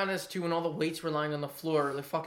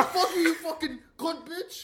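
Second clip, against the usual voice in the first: high, slow squeaky imitation laughing through it; hammed-up tough talk, much higher and slow; mock tough guy; 12 semitones higher, 4 dB louder, slower than usual. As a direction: stretch out the mock insult, pitched way up. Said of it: "Pepsi thinks he sounds tough as hell".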